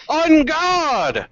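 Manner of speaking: high, drawn-out challenge; battle cry. Acted. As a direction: call out the challenge as you charge. Voices hero's battle cry.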